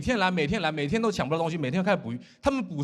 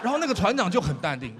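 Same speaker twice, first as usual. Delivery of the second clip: ordinary talk, nothing marked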